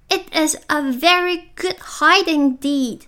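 lightly voiced angry caterpillar. A narrator's reading. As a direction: read the caterpillar's retort with mild indignation. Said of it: "said the caterpillar angrily".

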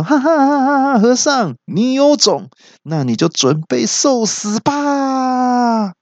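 high laughing challenge, slowing at the end; laugh, then threaten him slowly; mocking laughter. The big monster laughing at the monk, high slow threatening laugh. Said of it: "大怪听到之后就大笑说".

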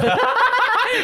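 high squealing laugh; burst of laughter. Real, not acted. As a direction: laugh out loud. Laughing at 交际花 joke. (大笑)

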